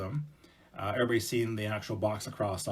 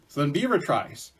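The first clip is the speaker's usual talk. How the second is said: ordinary delivery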